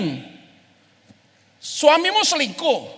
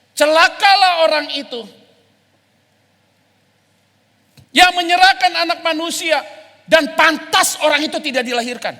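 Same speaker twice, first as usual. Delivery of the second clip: stern loud warning; 4 dB louder than usual. Retold or imitated. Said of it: "Dikasih kode keras aja sama Yesus".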